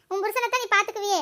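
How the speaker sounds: normal talking voice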